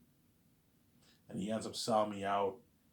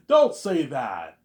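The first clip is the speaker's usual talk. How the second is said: drawn-out loud plea; slow loud plea; 5 semitones higher, 15 dB louder, much slower than usual. Retold or imitated. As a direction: stretch it out, loud. Repeat, louder.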